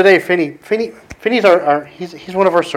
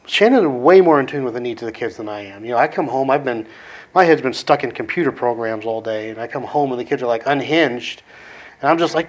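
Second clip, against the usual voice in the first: ordinary delivery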